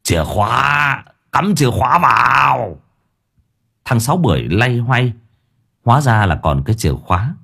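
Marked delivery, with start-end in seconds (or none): 0.0-2.8 higher, slower barked orders; old master shouts to helper about the key; shouting. A narrator's reading. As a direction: call out the keys urgently. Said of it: "Lão Năm lác hét".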